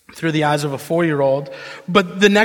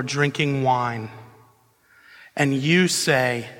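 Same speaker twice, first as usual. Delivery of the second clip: scripture reading; 5 semitones lower, 4 dB quieter than usual. Acted. Preacher reads Jesus' words to congregation, measured scripture reading. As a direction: read the verse gravely, with spaced phrases.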